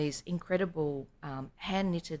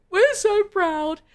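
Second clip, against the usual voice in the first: high, slow and louder, gushing; mock proud family; 12 dB louder, much slower than usual. The family reacting to the article, overjoyed and drawn out. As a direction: sing out the pride, drawn out. Family praising the bank manager.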